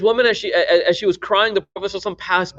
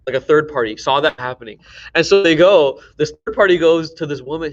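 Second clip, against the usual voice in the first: ordinary talk, nothing marked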